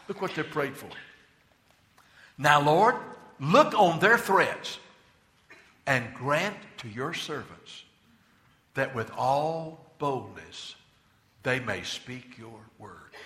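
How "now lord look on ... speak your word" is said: slow scripture prayer. Acted. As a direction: pray it slowly, fading to a hush. Reads the prayer slowly.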